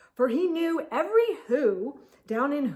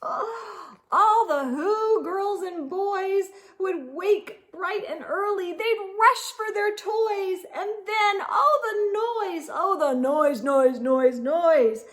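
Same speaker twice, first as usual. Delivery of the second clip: high, louder, disgusted mockery; mocking the noise; 8 semitones higher, 4 dB louder than usual. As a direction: sneer out the noise, louder each time. Grinch mimics the Whos' noise.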